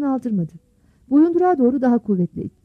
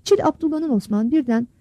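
ordinary talk

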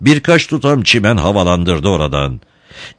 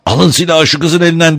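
high-pitched urgent order; shouting; 9 semitones higher, 3 dB louder than usual. A narrator's reading. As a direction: yell for someone to disarm her. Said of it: "diye bağırdı".

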